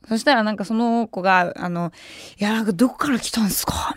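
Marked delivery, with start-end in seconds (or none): 2.4-3.9 voiceless whisper; whispered question. Retold or imitated. Drunk boy whispers his question to the staff. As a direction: hush it like a secret.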